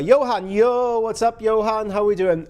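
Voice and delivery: calling out loudly to a friend; big loud hello, yo; loud greeting. Real, not acted. Streamer calling out loudly to friend Johan joining.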